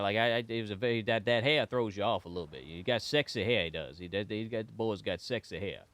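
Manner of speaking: lightly voiced folksy old-timer rambling; folksy Bob voice. Caster plays folksy character Bob.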